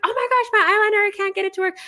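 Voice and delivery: high, loud frantic friend voice; mock panic over eyeliner, pitched high; panicked friend. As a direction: imitate a stressed contestant wailing. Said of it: "She's like".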